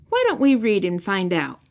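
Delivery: ordinary delivery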